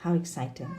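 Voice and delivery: mutedly sarcastic How exciting; flat, uninterested tone. Shown as a demonstration. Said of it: "Can you hear the difference there?".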